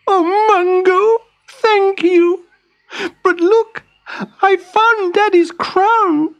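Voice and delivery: put-on very high, bright young princess. Prill's voice.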